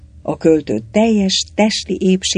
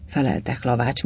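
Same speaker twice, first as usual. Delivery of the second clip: plain reading voice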